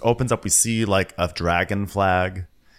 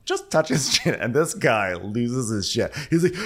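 usual speaking voice

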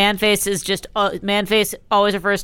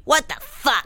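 Serafina voice; 4 semitones higher, 4 dB quieter than usual. Retold or imitated. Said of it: "Serafina's voice".

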